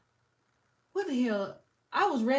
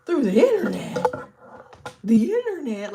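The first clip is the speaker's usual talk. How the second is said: much higher then loud, horrified what-the-internet; shocked grandma; 4 semitones higher, 7 dB louder than usual. Retold or imitated. Vlogger mimicking old folks horrified by paying online. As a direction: gasp at the word internet like it's poison. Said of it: "they be like".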